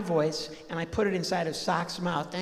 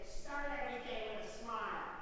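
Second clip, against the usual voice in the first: quoting grandma; 4 semitones higher, 15 dB quieter than usual. Retold or imitated. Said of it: "my grandmother would say".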